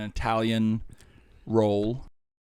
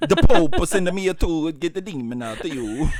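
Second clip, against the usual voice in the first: hammed-up Italian priest accent, louder; mock Italian accent; 5 semitones higher, 9 dB louder than usual. Co-host mocking Russell Crowe's Italian priest, loud hammed-up Italian accent. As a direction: ham up a drunken Italian exorcist. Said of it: "goddamn is he doing an Italian".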